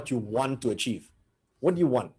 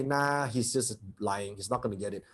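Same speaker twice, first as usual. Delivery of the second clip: a low, hushed voice of self-doubt; doubting inner voice; 5 semitones lower, 8 dB quieter than usual. Retold or imitated. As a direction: murmur it like the body's disbelief. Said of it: "your soul will say".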